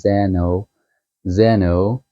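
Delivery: "Zeno" said with a short e, "ZEN-oh"; "Zeno" with an open first vowel; Greek pronunciation. Shown as a demonstration. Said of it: "I think you should pronounce it as".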